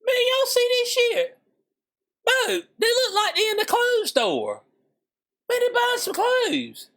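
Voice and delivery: high sneering disbelief at the footage; incredulous mockery. Real, not acted. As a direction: scoff at cops strolling a mall. Mocking police on a news clip.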